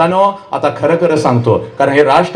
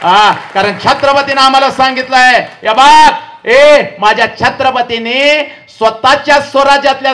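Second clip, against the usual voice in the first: high, ringing rally-speech tribute; impassioned public-speech delivery; rousing oratory; 4 semitones higher, 3 dB louder than usual. Real, not acted. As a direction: build proudly toward the king's name, drawing it out.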